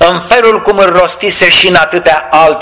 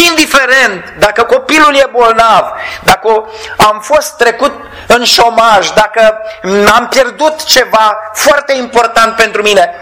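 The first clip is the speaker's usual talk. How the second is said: fervent preaching; 5 semitones higher than usual. Acted. Preacher asking his flock why they weep, in fervent sermon voice. Preacher presses the question to listeners.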